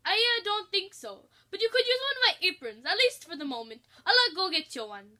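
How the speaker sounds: high, loud nervous chef. Acted. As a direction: offer help a little flustered. Chef voiced offering apron.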